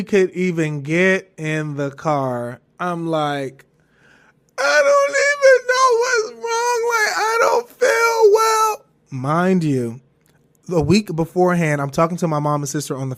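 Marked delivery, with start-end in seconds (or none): 4.6-8.8 high-pitched exaggerated sobbing wail; mock crying wail. Telling mom she doesn't feel well, hammed-up crying. Retold breakdown to mom, wailing.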